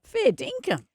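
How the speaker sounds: put-on a light touch of Australian accent; Aussie slang. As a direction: toss it out like a true-blue Aussie. Teasing Australian phrase to Canadian.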